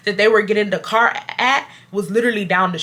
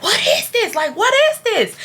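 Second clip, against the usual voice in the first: much higher, incredulous mock demand; imitating outraged customer; 12 semitones higher than usual. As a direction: mimic her shrieking disbelief. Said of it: "going off on him".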